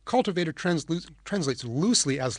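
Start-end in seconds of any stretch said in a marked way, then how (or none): none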